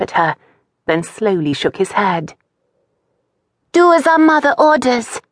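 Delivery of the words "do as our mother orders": put-on higher boy's voice, firm; a young boy gently giving orders; instructing. Brother instructing his little sister, firm boyish voice. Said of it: "he instructed".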